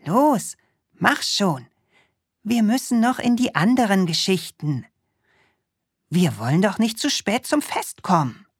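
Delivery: put-on high, fast, impatient boy voice; urging Krümel. Krümel tugs the witch to hurry to the party, fast and high. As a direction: hurry her along, quick and keen.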